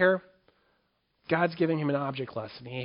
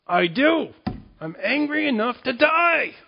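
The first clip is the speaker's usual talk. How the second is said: much higher, louder, slow and stubborn; defiant angry Jonah; 10 semitones higher, 6 dB louder, slower than usual. Acted. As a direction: spit it out like a sulking prophet who won't back down. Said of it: "Jonah's response".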